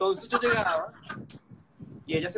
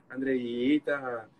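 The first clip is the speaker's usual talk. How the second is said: very slow, lower, drawn-out name; tender coaxing; 5 semitones lower, much slower than usual. Retold or imitated. Stroking her arm while coaxing her name slowly. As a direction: stretch her name out like a caress.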